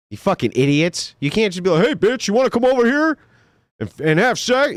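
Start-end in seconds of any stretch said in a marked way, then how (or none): 1.9-4.7 higher, brash, careless pickup line; the wrong way: blunt and pushy; crude come-on. Shown as a demonstration. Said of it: "You can't do that".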